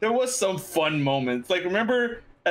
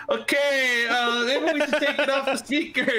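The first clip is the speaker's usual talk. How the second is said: higher, awkward flustered teacher; 7 semitones higher than usual. Retold or imitated. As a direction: play the embarrassed teacher, voice up. Teacher flustered on speaker call.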